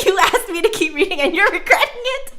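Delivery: loud and high, playfully teasing; teasing laugh. Real, not acted. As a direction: poke fun at the viewer loudly.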